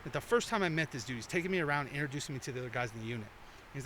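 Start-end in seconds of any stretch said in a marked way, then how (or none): none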